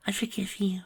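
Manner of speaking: high, soft pained whisper; hushed high forgiveness. Acted. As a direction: forgive through the hurt. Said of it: "she whispered in pain".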